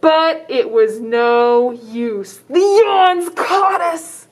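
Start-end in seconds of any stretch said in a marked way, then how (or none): 2.5-4.3 dramatic reveal. Acted. Dramatic storybook climax.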